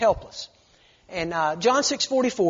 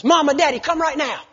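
higher, louder urgent call; urgent child call; 7 semitones higher, 4 dB louder than usual. Retold or imitated. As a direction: call for a parent in real need. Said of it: "this time it's not sarcastic".

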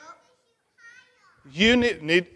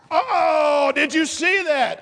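excited; 7 semitones higher, slower than usual. Real, not acted. Celebrating the third shot.

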